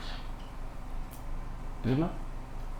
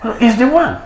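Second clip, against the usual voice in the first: higher, louder confirmation; emphatic friend; 11 semitones higher, 8 dB louder than usual. Acted. Friend confirms the appointment, loud and higher. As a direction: insist it's him.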